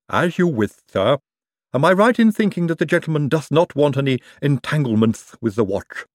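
servile Igor lisp; knowing, polite servant. A narrator's reading. Igor replies to Moist.

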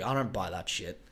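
ordinary talk

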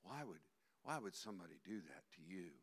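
low, soft, sly devil whisper; the devil coaxing a self-pitying person, low and hushed; devil's coaxing voice. Acted. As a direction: hush it into their ear like a tempter. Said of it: "the devil puts his arms around you and goes".

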